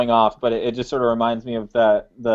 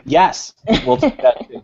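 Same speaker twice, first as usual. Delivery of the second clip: laughing through the words; slow, amused, laughing; 5 semitones higher than usual. Real, not acted. Joking about free beer.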